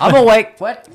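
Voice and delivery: high, loud jolted cry; startled. Pretend. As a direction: snap awake in panic. Startled viewer.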